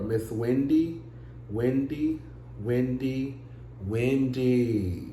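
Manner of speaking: slow, drawn-out sighing repetition of a name; dismayed lament. Real, not acted. Host lamenting bad news about Miss Wendy, dismayed and drawn out. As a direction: shake your head through each drawn-out name.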